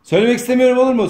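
normal talking voice

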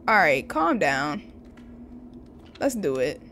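ordinary talk

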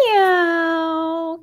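a long drawn-out cat meow; kitty meow. Pretend. Teacher meowing like a kitty for the children. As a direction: stretch out a cat's meow. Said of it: "they always say".

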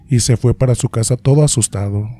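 ordinary delivery, nothing marked

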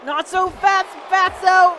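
high, forceful taunt; taunting shout. Real, not acted. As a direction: call out to stop the opponent. Calling at game opponent.

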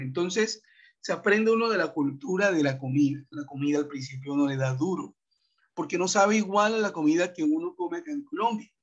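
ordinary delivery

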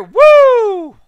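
high, loud cheer; excited whoop. Real, not acted. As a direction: whoop with joy. Cheering a jump.

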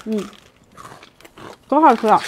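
eating crispy snack mid-sentence; talking while chewing. Real, not acted. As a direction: mouth full of crunchy crust. Seen 1: Bites crispy crust at start, chews while speaking, then mouth clears.

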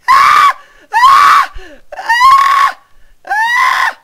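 high, loud panicked screaming; terrified screams. Real, not acted. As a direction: scream in real fright. Screams while chased.

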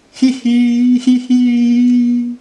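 high, loud hee-hee giggle; cartoon elephant tittering; acted giggle. A narrator's reading. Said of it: "He began to giggle".

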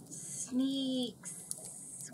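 soft, distant whisper. A narrator's reading. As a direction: breathe the word quietly. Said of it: "whispered the soft voice".

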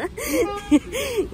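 high laugh on the edge of tears; nervous laugh. Real, not acted. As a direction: laugh from frustration, near crying. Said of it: "sinirden gülüyorum".

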